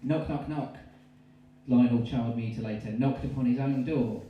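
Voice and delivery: ordinary talk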